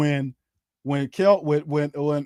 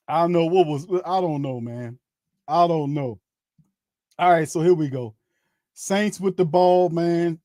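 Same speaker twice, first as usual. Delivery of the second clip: ordinary delivery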